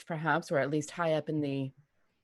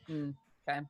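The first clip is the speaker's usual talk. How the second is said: deadpan; 2 semitones lower, 7 dB quieter, much faster than usual. Retold or imitated. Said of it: "completely straight face".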